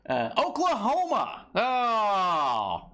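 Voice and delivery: excited. Real, not acted. Excited reveal of the location.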